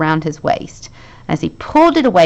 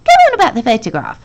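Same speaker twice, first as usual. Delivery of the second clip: eager urging; 5 semitones higher, 6 dB louder, much faster than usual. A narrator's reading. Eager friend urges.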